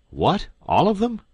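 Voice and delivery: sharp, high, loud disbelief; startled question. Acted. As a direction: jump on it in disbelief. Duke startled by the news.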